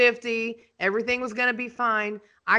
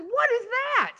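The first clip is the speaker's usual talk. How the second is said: incredulous yell; 13 semitones higher than usual. Retold or imitated. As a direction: demand it in disbelief. Repeating the demand.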